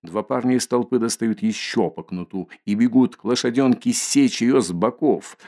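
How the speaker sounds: ordinary delivery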